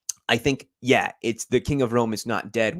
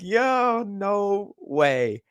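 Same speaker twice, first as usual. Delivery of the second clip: much higher, excited yelp of disbelief; amazed disbelief; 11 semitones higher, much slower than usual. Retold or imitated. Fan reacting to the goal call with high excited disbelief. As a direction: gasp it out like you can't believe it. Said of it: "And I'm like".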